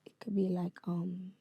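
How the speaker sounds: whispered British accent echo. Shown as a demonstration. Guest parroting the host, whispered British accent. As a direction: copy it under your breath in a British accent. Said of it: "Try it, try it".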